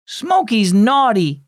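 put-on slow, high childish pronouncement; pouty declaration. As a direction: declare it like a sulky little girl. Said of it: "Jada declared".

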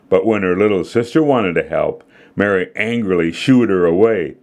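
ordinary delivery, nothing marked

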